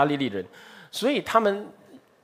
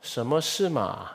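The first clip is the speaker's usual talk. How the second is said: standard Mandarin; 4 semitones lower, 4 dB quieter, slower than usual. Shown as a demonstration. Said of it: "很正统的普通话".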